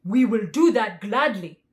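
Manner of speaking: loud tiger voice; the tiger, gladly agreeing; tiger's voice. A narrator's reading. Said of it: "returned the tiger".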